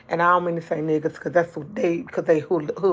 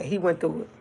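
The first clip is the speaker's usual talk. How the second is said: usual speaking voice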